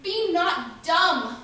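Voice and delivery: normal talking voice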